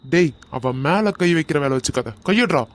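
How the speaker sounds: loud threatening order; angry warning. Acted. Man warning the attacker to take his hand off, angry. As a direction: bark it as a warning to back off.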